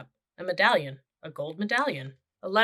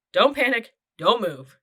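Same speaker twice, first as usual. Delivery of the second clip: urgent warning; 5 semitones higher, 7 dB louder than usual. A narrator's reading. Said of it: "said Jack".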